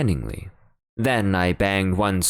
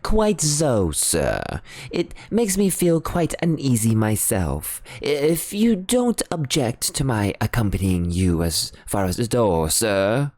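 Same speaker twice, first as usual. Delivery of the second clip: higher, politely uneasy butler voice; 5 semitones higher than usual. Acted. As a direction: offer to come along, a bit nervous. Parker uneasy.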